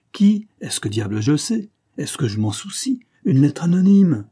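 irritated grumble, jumping high on key words; acted testy retort; grumbling. A narrator's reading. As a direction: grumble back, a bit exasperated. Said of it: "grogna-t-il".

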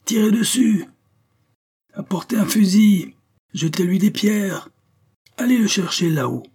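high, loud cries from an angry crowd; violent shouts. A narrator's reading. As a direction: shout it up at the roof, one order after another. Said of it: "Des cris violents montaient".

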